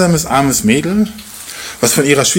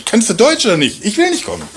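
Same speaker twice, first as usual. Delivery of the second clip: higher, mock sarcastic impatience; pitched-up mock annoyance; exasperated retort; 7 semitones higher than usual. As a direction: snap back at the elder, half-joking. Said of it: "Ungefähr so".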